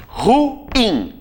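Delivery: "Ruim" high, split into ru-IM; stress on IM. Shown as a demonstration. Teacher shows the tonic syllable, ru-IM with stress on IM. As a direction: make "Ruim" two syllables, leaning on IM. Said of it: "a sílaba tônica é o in".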